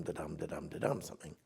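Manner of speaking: quiet da-DUM da-DUM, stress on dum; iambic beat. Shown as a demonstration. Poet to host, sketching the expected meter: soft rhythmic da-DUM. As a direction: tap the beat softly. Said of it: "You're expecting the next line to be da-dum".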